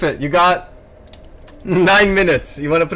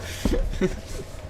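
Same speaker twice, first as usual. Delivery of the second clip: giddy breathy laugh; 9 dB quieter than usual. Real, not acted. Laughs after the discovery.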